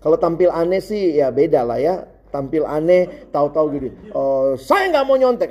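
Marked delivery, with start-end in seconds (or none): 4.1-5.5 high, drawn-out proclamation, imitating an odd classmate; dziwny kolega. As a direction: announce it slowly like the weird kid. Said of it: "Ada teman saya gitu, aneh banget".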